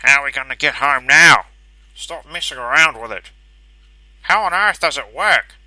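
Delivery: loud, high, panicked shouting; muffled shouting. A narrator's reading. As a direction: shout through a wall in panic. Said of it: "hear the shouting in the next room".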